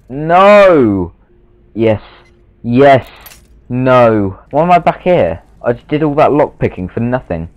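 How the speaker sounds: high, loud exasperated outbursts; frustrated yelling. Real, not acted. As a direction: yell at the game in frustration. Lockpicking frustration.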